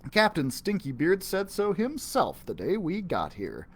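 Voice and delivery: plain reading voice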